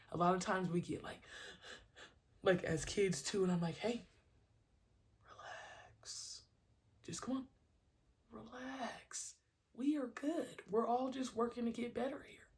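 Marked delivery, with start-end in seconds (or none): 3.8-12.5 put-on gently calm the kid down; reassuring coach. Coach to a teary-eyed kid, reassuring.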